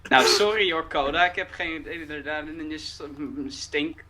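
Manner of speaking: hammed-up Dutch speaker; bad Dutch accent. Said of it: "have a very bad Dutch accent".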